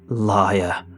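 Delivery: hag's low-pitched cry; a contained scream of accusation. A narrator's reading. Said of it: "she screamed".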